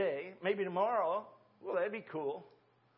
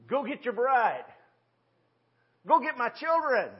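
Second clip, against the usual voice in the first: 1 commanding call; 4 semitones higher, 5 dB louder than usual. Acted. God's command voiced in sermon.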